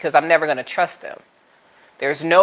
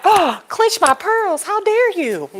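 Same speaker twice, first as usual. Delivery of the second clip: high, slow, scandalized "clench my pearls"; prim pearl-clutcher; slower than usual. Pretend. Her prudish side scolding the bold man, high and scandalized. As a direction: play a shocked prude. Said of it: "the purity side of me is like".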